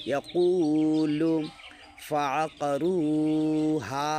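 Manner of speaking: slow chanted recitation, vowels drawn long; Quran recitation. Acted. Teacher chanting the verse for the class. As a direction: recite it melodically and slowly.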